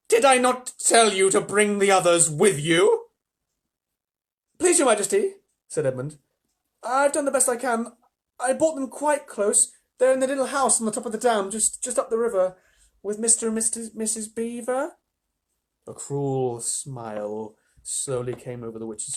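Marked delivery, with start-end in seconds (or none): 0.1-3.1 loud, slowed scolding queen; terrible witch voice. Acted. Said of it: "said the witch in a terrible voice". 4.6-15.0 put-on pleading boy. Edmund pleading with the Witch.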